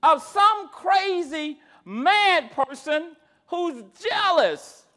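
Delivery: slow, hammered sermon phrases; forceful preaching. Real, not acted. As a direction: pound out each phrase with pauses.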